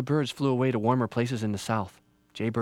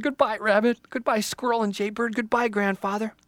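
high little voice, growing louder; anxious, brave Little Tree; 8 semitones higher, 3 dB louder than usual. Acted. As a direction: build from wonder to frightened farewells. Little Tree faces the axe.

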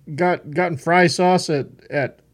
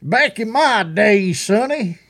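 put-on higher, slow old-timer voice; old man voice; 4 semitones higher, slower than usual. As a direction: play a cranky grandpa scolding a kid. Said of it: "you're hearing that old man come out of me".

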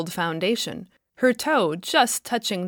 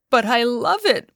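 higher, louder tearful plea; weeping; 4 semitones higher, 7 dB louder than usual. A narrator's reading. Woman clinging to her treasure, weeping plea. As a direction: cry out her love for it. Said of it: "wept the woman".

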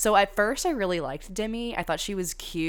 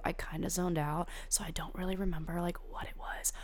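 breathy confessional whisper; whispered aside; 8 dB quieter than usual. Real, not acted. Whispering to viewers that she zoned out. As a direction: drop to a whisper as if admitting a secret.

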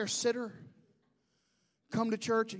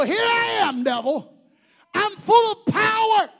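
high, full-throated defiant shout; making yourself big; 11 semitones higher, 16 dB louder than usual. Shown as a demonstration. Believer declaring power to the devil, booming defiant shout. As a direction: roar it out like a preacher facing down the devil. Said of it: "How do you make yourself big? You go".

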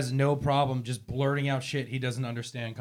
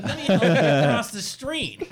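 mock helpful; 6 semitones higher, 4 dB louder, slower than usual. Mocking old lady assault.

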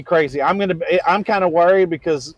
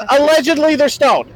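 allegedly pushed high and loud; exaggerated allegedly; 10 semitones higher, 5 dB louder than usual. Joking emphasis on allegedly.

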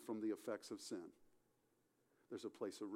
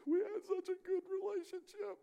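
much higher, strained voice as if through tears; imitating weeping widow; 4 dB louder than usual. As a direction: voice her grief, almost breaking. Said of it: "she wept bitterly".

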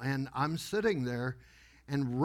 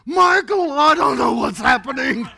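high, loud mock-helpless yell; panicked shout; 9 semitones higher, 11 dB louder than usual. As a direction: cry out to a brother-in-law in hammed-up panic. Re-enacting panicked call to brother-in-law.

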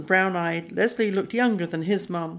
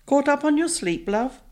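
stepmother voice; 8 semitones higher than usual. A narrator's reading.

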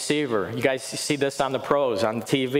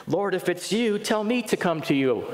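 a hint of Peter calling out; 7 semitones higher than usual. Peter voiced calling to Jesus.

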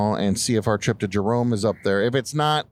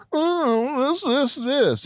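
whiny nitpicker; much slower than usual. Retold or imitated. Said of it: "people just wanna nitpick".

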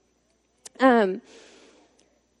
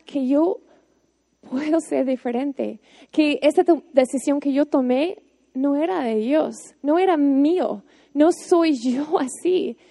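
usual speaking voice

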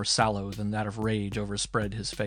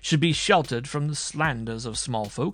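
higher, louder, furious haughty demand; outraged tirade; 6 semitones higher, 5 dB louder than usual. A narrator's reading. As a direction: rage at being accused by servants. Marshal's outraged tirade to judge.

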